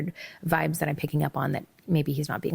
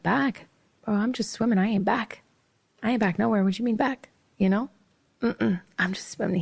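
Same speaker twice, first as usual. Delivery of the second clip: higher, sassy disbelief; incredulous sass; 5 semitones higher than usual. Retold or imitated. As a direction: push back, amused and baffled. Incredulous retort to him.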